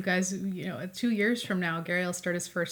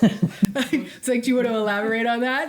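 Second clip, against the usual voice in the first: laughing; 4 semitones higher, 6 dB louder than usual. Real, not acted. Teasing husband, laughter heard.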